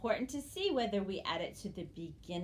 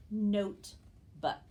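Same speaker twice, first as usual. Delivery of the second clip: notebook said slowly and louder, drawn out; slow reveal; 4 dB louder, much slower than usual. Shown as a demonstration. Teacher revealing notebook slowly to the class. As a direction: stretch notebook out so kids hear both parts. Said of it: "My new word is".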